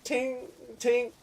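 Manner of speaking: high, soft ringing "ting"; imitated ting. Gamer showing long-range hits, high imitated ting. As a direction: imitate shots pinging far off in a high voice. Imitates shots hitting across map.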